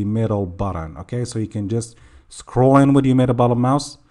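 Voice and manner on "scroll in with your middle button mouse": rushed, words running together; too fast. Real, not acted. Tutor hurrying through the mouse instruction, too fast. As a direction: rattle it off a bit too quickly. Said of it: "sorry I said that too fast".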